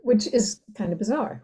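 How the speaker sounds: normal talking voice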